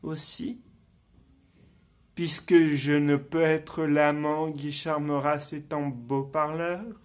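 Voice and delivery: low, soft stage soliloquy; hushed and low, in measured phrases with long pauses; brooding confession. Acted. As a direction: confide it darkly, as if thinking aloud. Acted villain confides his resolve.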